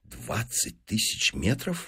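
hushed, slow «Двадцать тысяч метров?» rising in disbelief; stunned exclamation. Acted. Professor taken aback by the demand, hushed disbelief. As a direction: question «Двадцать тысяч метров?» softly, far below a shout. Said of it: "воскликнул Бус".

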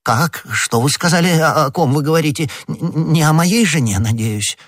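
high, flustered questions; alarmed stammering. Acted. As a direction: stumble over the words in rising worry.

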